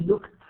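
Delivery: "looked" ending in T; final T sound. Shown as a demonstration. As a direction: say "looked" as one syllable, ED as T. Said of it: "عم بلفظها T".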